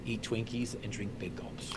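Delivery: hushed, conspiratorial whisper; whispered punchline. Acted. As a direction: drop to a whisper for the reveal. Punchline aside to audience.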